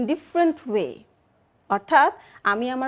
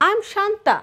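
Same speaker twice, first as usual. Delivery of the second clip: normal talking voice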